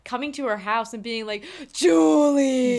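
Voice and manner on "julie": loud, high shouted name; calling out. Acted. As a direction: holler her name and draw it out. Acted boyfriend calling at her house.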